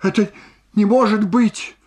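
high, loud disbelieving exclamation; alarmed cry. Acted. Alarmed cry to her husband. (встревоженный возглас)

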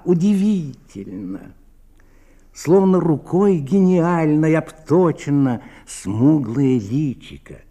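usual speaking voice

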